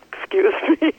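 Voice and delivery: laughing through tears; teary laugh. Real, not acted. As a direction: breaks into an embarrassed little laugh. Embarrassed at getting emotional.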